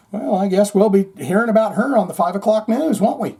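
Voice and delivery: mock the people who just sit there; mock sarcastic bystander. Mocking bystanders who ignore a harassed woman, sarcastic.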